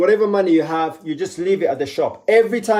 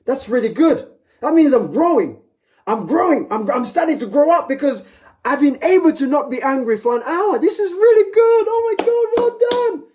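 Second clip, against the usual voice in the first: very high, excited squeaky voice praising himself; rising to a giddy squeal of self-congratulation; cartoonish high-pitched pat on the back; squeaky self-praise; 9 semitones higher than usual.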